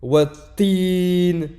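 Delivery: Wat-tin plus fort, le t doublé et appuyé; shadda appuyée. Shown as a demonstration. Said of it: "on insiste avec la shadda". (insister sur la shadda)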